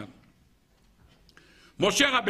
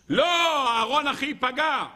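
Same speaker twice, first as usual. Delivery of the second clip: Moses protesting; 6 semitones higher, slower than usual. Acted. Moses voiced pleading.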